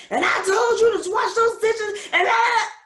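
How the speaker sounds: high, loud mock yell at the kids; mock screaming parent. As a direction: bellow it like a fed-up parent scolding over dishes. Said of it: "Watch yourself scream all that garbage".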